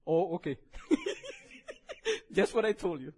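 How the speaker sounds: loud laughing, laptop died; real laughter. Real, not acted. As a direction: laugh it off when the screen goes out. Laptop dies mid-sermon.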